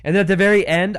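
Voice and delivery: normal talking voice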